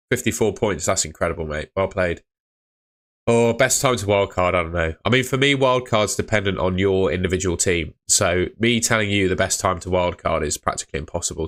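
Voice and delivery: ordinary delivery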